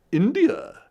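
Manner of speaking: very high, loud regal exclamation; Queen Victoria voice. Acted. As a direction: sound like the old queen. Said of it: "in his Queen Victoria voice".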